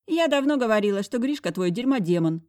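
high, loud indignant friend. Acted. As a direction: declare it triumphantly.

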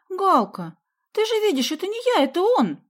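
very high, pleading self-defence; loud, high-pitched childish excuse; making excuses. A narrator's reading. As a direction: protest and shift the blame. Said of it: "стала оправдываться Соня".

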